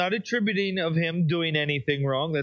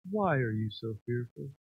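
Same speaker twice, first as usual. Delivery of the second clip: mock pious calm; 9 semitones lower, 7 dB quieter than usual. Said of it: "I'm sure he just was so pious".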